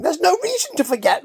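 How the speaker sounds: high, pleading exasperation; exasperated. Retold or imitated. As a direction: voice it as a fed-up husband. Retold exasperated husband quote.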